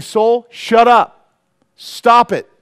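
loud, high rebuke to oneself; preacher's commanding telling-off; acted stern scolding. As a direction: scold your own soul, slow and forceful. Acts out scolding his own soul.

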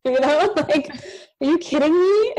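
high, loud laughing protest; laughing disbelief. Retold or imitated. Retelling her reply to God, laughing disbelief. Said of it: "And I was like".

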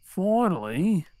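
relieved but fed up; exasperated call. Acted. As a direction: let the wait show in one slow word. She finally comes downstairs.

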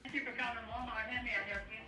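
ordinary talk, nothing marked

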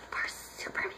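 very soft whisper; whispered aside. Retold or imitated. Someone remarking on the jealous ex, whispered aside. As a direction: slip it in under your breath, whispered.

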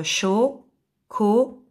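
very slow "chocolat", each syllable alone. Shown as a demonstration. Teacher repeating for pupils, "chocolat" syllable by syllable. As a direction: split "chocolat" with long gaps, cho... co... lat. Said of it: "si je supprime la première syllabe".

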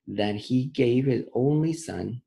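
slow, deliberate reading, word by word; slowly. Shown as a demonstration. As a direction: read it slowly so the kids catch every word. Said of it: "Let's read it slowly".

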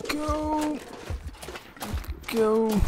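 sharp high cry; urgent shout. Real, not acted. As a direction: yell at yourself to run. Running from bear.

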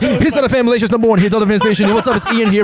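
high, fast hyped radio intro; radio announcer. Retold or imitated. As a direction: hype it like a station jingle. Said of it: "Ian will go like".

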